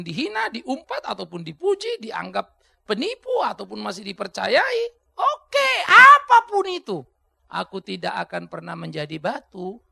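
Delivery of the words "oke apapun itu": high, loud, slow proclamation; climactic shout. Real, not acted. Preacher vowing never to be a stumbling block, slow loud shout. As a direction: build to the peak and hold it.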